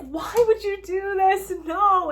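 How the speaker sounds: high, loud and slow, crying out; anguished cry. Acted. Heroine crying out at the dying Van Helsing, anguished. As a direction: wail the question in disbelief. Heroine sees him stab himself.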